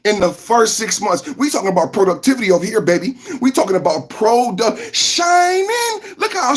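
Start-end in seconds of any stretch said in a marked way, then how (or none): 5.0-6.5 sudden high squeal; squeaky shine. Acted. Streamer bragging about his shiny face, high squeal. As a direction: squeal it proudly.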